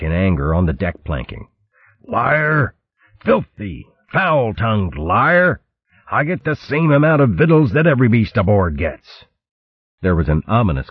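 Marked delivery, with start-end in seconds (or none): 2.0-9.2 indignant fox captain. Acted. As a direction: spit "liar" in wounded fury. Chopsnout denies the insult.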